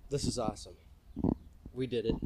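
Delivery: ordinary talk, nothing marked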